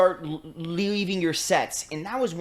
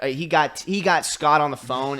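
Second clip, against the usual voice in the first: ordinary talk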